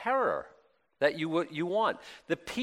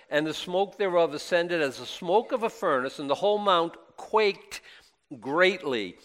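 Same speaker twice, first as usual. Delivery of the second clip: louder, solemn King James reading; scripture reading; 3 semitones higher, 5 dB louder than usual. Acted. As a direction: read it out like the Bible on the pulpit. Reads the scripture aloud.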